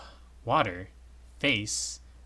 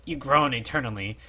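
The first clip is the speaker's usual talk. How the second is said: normal talking voice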